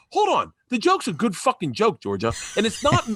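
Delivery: high-pitched, indignant self-defence; pissy. Retold or imitated. Comedian dad defending his joke to his daughter, pissy. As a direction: act out a dad getting testy and defensive. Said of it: "I get fucking pissy".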